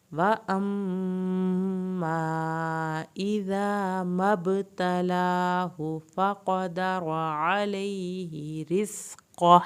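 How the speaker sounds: slow, drawn-out melodic tajweed recitation; Quran recitation. Shown as a demonstration. Teacher reciting the verse for students, slow melodic recitation. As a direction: chant the verse slowly, stretching every long vowel.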